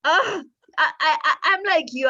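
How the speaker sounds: real laugh holding back crying; laughing not to cry. Real, not acted. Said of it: "I start laughing because I don't want to cry".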